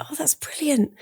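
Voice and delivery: breathless awed whisper; excited whisper. Real, not acted. Host whispering her delight at the guest's news. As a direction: hush it out in delight.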